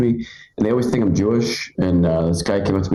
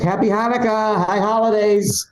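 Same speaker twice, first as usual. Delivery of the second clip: imitated calling out; 12 semitones higher than usual. Driver greets a stranger, mock hollering. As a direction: holler it like a maniac from a passing car.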